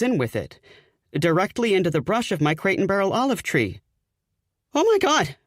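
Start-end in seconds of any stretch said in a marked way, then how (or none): 4.7-5.4 sudden high shriek of fright; startled shriek. Acted. Narrator as startled fiancée, high frightened shriek. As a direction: shriek it, caught off guard by a bird. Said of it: "I shriek".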